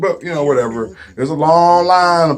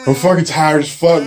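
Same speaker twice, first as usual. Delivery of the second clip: ordinary talk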